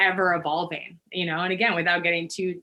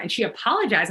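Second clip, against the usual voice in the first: usual speaking voice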